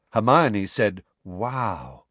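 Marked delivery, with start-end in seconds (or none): 1.2-2.0 soft, hushed wow; softly. A narrator's reading. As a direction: breathe it out in quiet awe.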